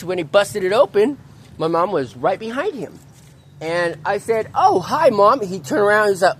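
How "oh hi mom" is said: high, loud "oh, hi" to mom; mock-casual greeting. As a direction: call out a hello, pitched way up.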